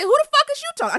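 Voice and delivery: high-pitched, incredulous comeback; retold indignant challenge. Telling how she challenged her co-host, high mock outrage. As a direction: act out taking offence, voice shooting up. Retells confronting co-host over tone.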